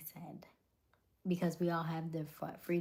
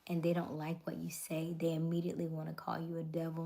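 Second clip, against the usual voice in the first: ordinary talk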